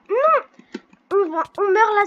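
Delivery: high, loud cry of alarm; alarmed shout. Real, not acted. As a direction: yell no in sudden panic. About to die.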